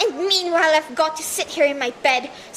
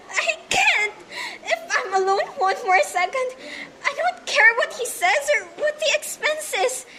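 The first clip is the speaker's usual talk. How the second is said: higher, tearful, near hysterical; panicked sobbing; 5 semitones higher, 4 dB quieter than usual. Acted. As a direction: sob it out, fear rising. Invalid panics at being alone.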